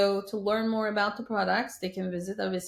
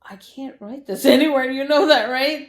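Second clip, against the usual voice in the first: laughing, higher and much louder; laughing disbelief; 6 semitones higher, 11 dB louder than usual. Retold or imitated. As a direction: laugh it off, half protesting. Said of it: "And I was like".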